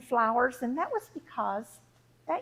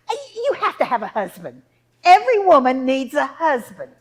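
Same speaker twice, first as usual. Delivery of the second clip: loud, emphatic rebuke; insistent mother; 11 dB louder than usual. Acted. Mother insisting her daughter needs a husband, loud and insistent. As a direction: insist forcefully, building louder.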